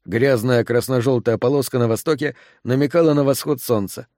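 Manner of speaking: ordinary delivery, nothing marked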